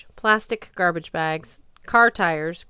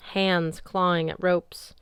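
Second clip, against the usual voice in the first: usual speaking voice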